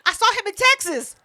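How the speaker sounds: high, loud, fast tirade; trash-talking rant. Retold or imitated. Ticket holder tired of waiting, high indignant rant. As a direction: rattle it off high and outraged. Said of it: "I'm talking mad shit".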